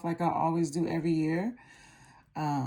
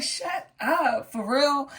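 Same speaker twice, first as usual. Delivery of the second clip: amazed disbelief; 6 semitones higher, much slower than usual. Retold or imitated. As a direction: gasp it out, thrilled. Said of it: "I was like".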